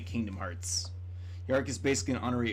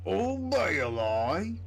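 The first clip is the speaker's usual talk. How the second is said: slow, high, stretched call of Bailey; drawn-out sing-song call; 7 semitones higher, 4 dB louder, much slower than usual. Pretend. Streamer greeting the Bailey animation with a slow sung call. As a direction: croon the name like a dramatic greeting.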